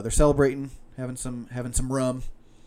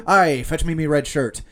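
louder pirate captain, slowing down hard on the punchline; 4 semitones higher, 7 dB louder, slower than usual. Acted. Captain delivers the brown pants punchline in a hammed-up pirate voice. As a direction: drag out the punchline pirate-style.

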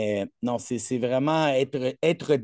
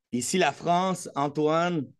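ordinary delivery

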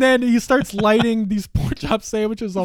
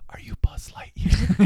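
whisper; 5 dB quieter than usual. Retold or imitated. Kid asking star, retold.

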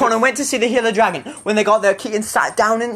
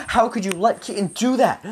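ordinary delivery, nothing marked

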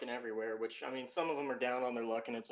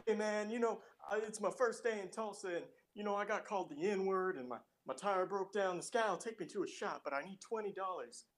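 higher, pleading beggar's pitch; panhandler voice; 7 semitones higher than usual. Retold or imitated. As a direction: play the smooth-talking panhandler. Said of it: "He's just like".

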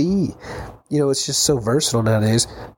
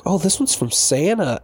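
higher, playful fake surprise; mock surprise; 7 semitones higher than usual. Kid reacting to the hidden Santa present, higher mock surprise. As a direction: play along like it's a surprise. Parents' trick present reveal.

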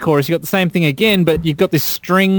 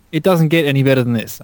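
ordinary talk, nothing marked

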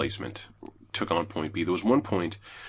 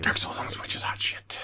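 hushed reverent whisper; a conspiratorial whispered boast; 5 dB quieter than usual. Real, not acted. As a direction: whisper it like a secret. Whispered hype.

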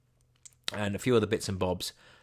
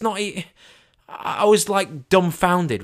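exasperated; 10 semitones higher, 8 dB louder, much slower than usual. Real, not acted. Exasperated telling, very high loud.

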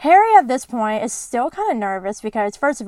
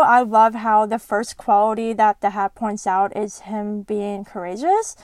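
ordinary talk, nothing marked

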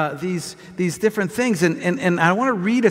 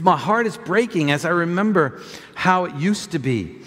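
lower, measured reading of a psalm; calm, lower scripture reading; 4 semitones lower than usual. Acted. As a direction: read it solemnly to the church. Reads the psalm aloud.

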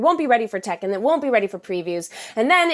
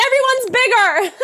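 high, loud startled cry; mock surprise; 12 semitones higher, 6 dB louder than usual. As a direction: pretend shock at everything looming. Imagined shock in car view.

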